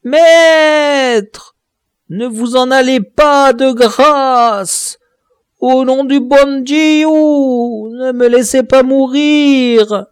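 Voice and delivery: high, loud, slow desperate begging; terrified dwarf begging for mercy, high and loud; pleading in terror. Acted. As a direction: beg for your life from the edge. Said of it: "suppliante et douloureuse".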